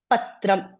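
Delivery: "Patram" as pat-tram, ta sound before ra; correct cluster pronunciation. Shown as a demonstration. Teacher modelling the written word, "Patram" with t then r. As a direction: say "Patram" with t straight into r. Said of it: "First you make the ta sound".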